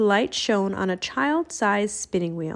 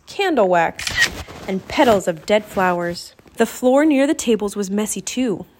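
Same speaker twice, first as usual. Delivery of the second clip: ordinary delivery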